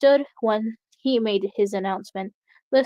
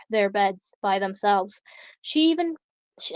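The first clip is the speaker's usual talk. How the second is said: ordinary delivery, nothing marked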